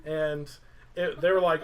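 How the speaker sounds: ordinary talk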